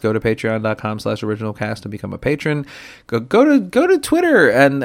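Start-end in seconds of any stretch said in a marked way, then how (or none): 3.1-4.8 excited. Real, not acted. Excited plug for socials.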